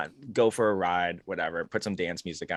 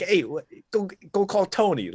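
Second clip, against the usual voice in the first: high, loud cartoon Italian mobster; 10 semitones higher, 4 dB louder than usual. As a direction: call out like a hammed-up Italian mafioso. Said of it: "that stereotypical, like, mafia".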